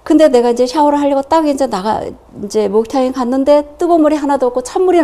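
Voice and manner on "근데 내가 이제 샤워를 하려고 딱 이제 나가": normal talking voice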